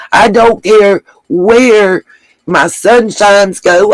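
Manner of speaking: slow, each word weighed, "where" held long and falling; slow emphatic statement. Real, not acted. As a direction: spell it out slowly so nobody misses it. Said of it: "let me make that real clear".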